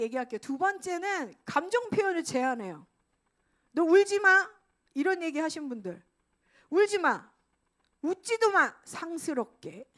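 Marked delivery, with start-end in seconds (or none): 3.7-4.5 loud, higher, sharp parental scold; stern scolding. Retold or imitated. A parent loudly scolding a crying child, stern. 6.7-9.9 louder and higher, then slow and dropping; stern scolding. Retold or imitated. A strict parent forbidding crying and laughing, stern scolding.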